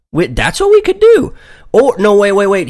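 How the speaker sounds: high, loud burst of an idea; excited. Acted. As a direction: blurt it out, suddenly inspired. Sudden bright idea.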